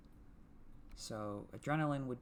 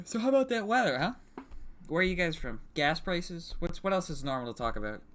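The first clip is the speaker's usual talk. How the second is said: mock small talk; 5 semitones higher, 5 dB louder than usual.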